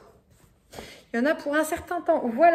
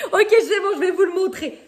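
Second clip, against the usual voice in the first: laughing; 5 semitones higher, 6 dB louder than usual. Real, not acted. Giving in, laughing, to viewers.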